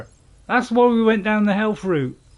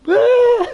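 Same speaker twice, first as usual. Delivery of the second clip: sudden high, loud startled shriek; creature shriek; 10 dB louder than usual. Retold or imitated. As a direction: shriek like a small frightened creature. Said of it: "like, it goes".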